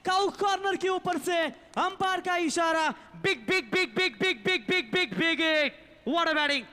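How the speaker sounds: loud, high rhythmic chant of big; excited cricket commentary. Real, not acted. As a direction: build the crowd with every big. Six signalled, chanting big.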